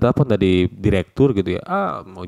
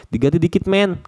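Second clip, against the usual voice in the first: slangy, rising to a higher pitch on men; casual slang; 9 semitones higher than usual. Shown as a demonstration. Teacher demoing casual Jakarta slang among friends. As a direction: toss it off like talking to a buddy. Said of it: "menurut gua ini".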